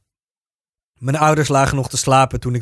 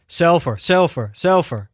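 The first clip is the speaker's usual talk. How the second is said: calling out; 5 semitones higher, slower than usual. Retold or imitated. After the crash, calling for her missing friend, raised call. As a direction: shout her name into the dark, searching. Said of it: "begon er te roepen".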